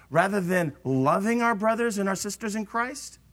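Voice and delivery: ordinary talk, nothing marked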